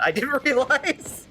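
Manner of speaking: high, laughing protest; amused disbelief. Real, not acted. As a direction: say it through rising laughter. Laughs at own mistake.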